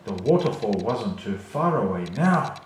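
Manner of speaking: plain reading voice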